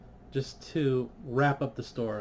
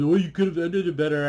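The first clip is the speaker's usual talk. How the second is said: louder whiny mock critic voice; 4 semitones higher, 6 dB louder than usual. Fan mimicking online critics, louder mock whine. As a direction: parrot the critics' nagging, louder. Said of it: "'Cause I know they're gonna be".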